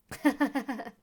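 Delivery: quick, loud burst of laughter; a high rapid chuckle. Real, not acted. Laughs after reciting the speech.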